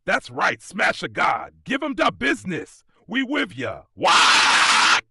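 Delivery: high, rowdy cheers ending in a shout; a crowd of orcs going wild, yelling their god on; wild orc cheering. A narrator's reading. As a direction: egg the big guy on like a mob of thrilled orcs. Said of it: "The orcs erupt".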